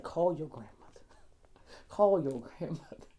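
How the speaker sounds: low, soft prompting, repeated after a pause; hushed voice of an inner calling, imitated; quiet inner voice. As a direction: say it low and soft, like a nudge from within.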